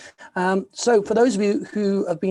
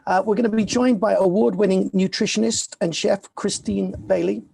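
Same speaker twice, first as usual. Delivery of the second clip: usual speaking voice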